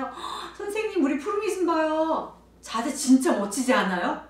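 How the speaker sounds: gasp, then high, loud gushing praise; showy admiration. Acted. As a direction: show the child off to a fellow teacher. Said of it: "우리 옆에 있는 선생님한테요". (과장되게 감탄하며 자랑하는 말투)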